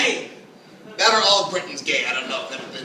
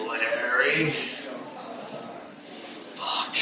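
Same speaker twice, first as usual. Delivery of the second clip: gruff man; 6 semitones lower, 4 dB quieter, slower than usual. Retold or imitated. Bearded man answers.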